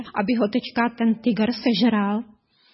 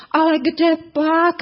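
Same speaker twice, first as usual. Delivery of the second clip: higher, innocent tiger voice; tiger's protest; 6 semitones higher, 3 dB louder than usual. A narrator's reading. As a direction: brush the worry away sweetly. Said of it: "odvětil tygr".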